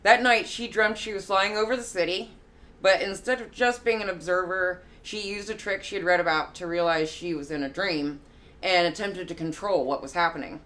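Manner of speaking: usual speaking voice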